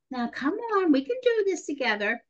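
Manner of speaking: high, sugary coaxing; sweet little voice. Retold or imitated. Young nurse trying again with the patient, sweet little voice. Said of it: "I said in my sweet little voice".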